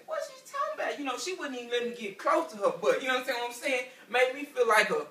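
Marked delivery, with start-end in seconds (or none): 0.1-1.0 much higher, squeaky confusion; mock stunned squeak; baffled disbelief. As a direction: pitch way up in bewilderment. Baffled husband.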